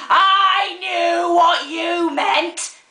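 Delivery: high, drawn-out full shout; long, loud, outraged yell. Acted. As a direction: bellow each word slowly at a high pitch. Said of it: "I shouted".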